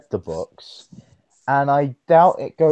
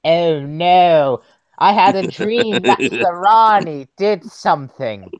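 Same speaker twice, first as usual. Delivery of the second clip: hammed-up character voice, slightly raised; theatrical put-on voice announcing a dream; Seventh Doctor; 3 semitones higher, 3 dB louder, slower than usual. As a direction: have a try at an impression, playing up the dismay. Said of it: "you do the Seventh Doctor voice".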